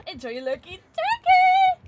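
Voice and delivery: very high, loud excited cry; gleeful exclaim. Real, not acted. Streamer cheering a teammate's lucky play, high and loud. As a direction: crow it out gleefully.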